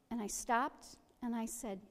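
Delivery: normal talking voice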